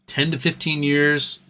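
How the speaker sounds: ordinary talk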